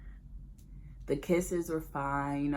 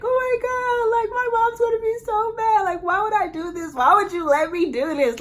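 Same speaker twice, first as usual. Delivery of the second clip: high, loud wailing sob of the words, much higher; retold bawling; 6 dB louder than usual. Telling the listeners how she cried to her boyfriend, high loud wailing. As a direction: cry it out like a panicked girl who just made a mistake. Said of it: "sitting here bawling my eyes out".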